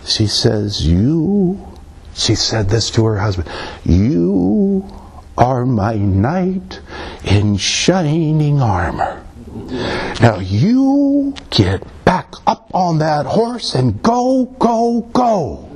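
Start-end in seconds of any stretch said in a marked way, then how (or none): 0.8-1.6 high, drawn-out film wife; imitation of an old film's leading lady; imitated film wife. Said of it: "she's talking like this". 3.8-15.7 high, slow, drawn-out wife impression; theatrical high-pitched imitation of a film heroine; imitated film wife. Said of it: "she's talking like this".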